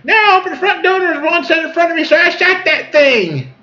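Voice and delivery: squeaky high female voice; hammed-up high-pitched retelling; imitating her. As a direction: explain it in an excited falsetto. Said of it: "She goes".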